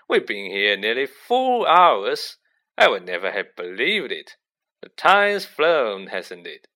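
theatrical surprise, swooping up loud; breezy, astonished Lockhart. Acted. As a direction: exclaim grandly, with big swings in pitch. Figure of Lockhart in narrated story.

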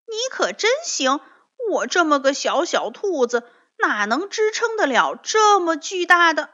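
high, loud angry retort; furious. Acted. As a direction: snap back, furious and indignant. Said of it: "蹦蹦也气坏了". (气坏了地反驳)

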